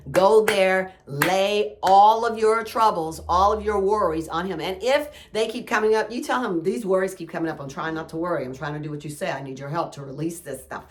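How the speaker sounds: exhorting the listeners to bring their worries to God; fervent preaching. Acted. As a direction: preach it with fire, build each line.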